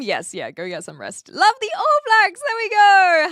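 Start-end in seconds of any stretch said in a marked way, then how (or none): 1.3-3.3 much higher, louder cheer. Real, not acted. Streamer cheering a fan of the All Blacks. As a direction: cheer it out for the team.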